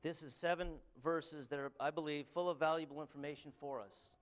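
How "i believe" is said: normal talking voice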